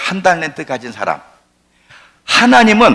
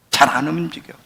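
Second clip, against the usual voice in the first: ordinary delivery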